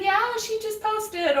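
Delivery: lifted, bright retelling of her own answer; mimicking how she answers people who ask about her daughter, cheerfully; cheerful reply. Friend repeating her reply to curious people, lightly mimicked. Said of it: "I say".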